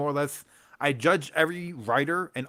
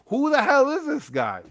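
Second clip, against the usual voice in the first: incredulous; 12 semitones higher, 6 dB louder than usual. Retold or imitated. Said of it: "I'm like".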